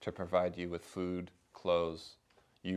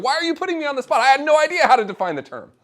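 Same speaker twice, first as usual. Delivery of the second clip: high, loud playful complaint; mock indignant professor; 11 dB louder, faster than usual. Professor admitting to the speaker he had no idea, mock outrage. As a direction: pretend outrage, not really mad. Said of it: "He wasn't actually mad".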